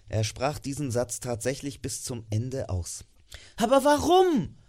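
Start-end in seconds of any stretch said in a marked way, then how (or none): 3.6-4.6 high, loud, bewildered cry; called out. A narrator's reading. Nephew cries out at his uncle's refusal, high and pleading. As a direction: cry out in disbelief. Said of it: "rief Scrooges Neffe".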